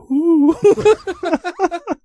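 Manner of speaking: high-pitched laughter. Real, not acted. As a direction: crack up. Laughing at bird call.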